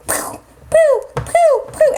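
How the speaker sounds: high, puffed "pooh" noises; kick sound effects. Acted. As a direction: play-act a flurry of kicks. Imitates kicking the bad guy.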